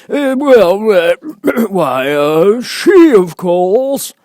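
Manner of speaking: high, loud, important bird voice; grand old bird holding forth; pompous Dodo. Acted. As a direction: puff up like a self-important Dodo.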